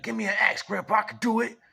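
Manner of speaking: high, loud plea to grandpa; eager kid. Retold or imitated. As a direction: beg loudly in a high child's voice. Child begs grandfather for axe.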